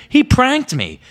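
high, loud outraged cry. Retold or imitated. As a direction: yell the accusation. Kid fuming at prankster friend.

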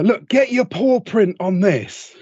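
cheeky request, lightly acted; a hint of a jokey bloke voice; imitating Jackie. Jackie voiced asking Jilly.

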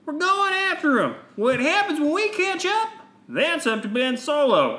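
high, bright heroic voice; young Jedi voice. Acted. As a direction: play the eager young Jedi. Voicing Jedi character.

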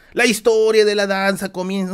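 mock the judge's grand opening; pompous announcer. Imitating the judge's solemn start to the results, loud announcer voice. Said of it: "El jurado comienza a decir".

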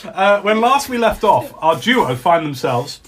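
giggly false start; laughing. Real, not acted. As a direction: start the recap while holding back laughter. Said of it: "If you're wondering why we're laughing".

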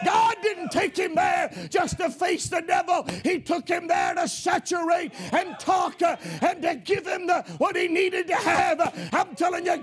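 full-throated revival preaching, rising to a shout; preaching at full force. Real, not acted. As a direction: preach it hard and build the fire.

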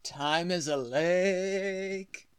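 very slow, stretched-out line; slow and stretched, lingering on the final word; drawn-out pronouncement. Acted. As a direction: draw every word out, holding the last one long. Drawn-out mock-mystical quote line.

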